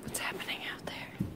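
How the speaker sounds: hushed curious whisper. Real, not acted. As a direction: breathe it softly. Looking out window, hushed.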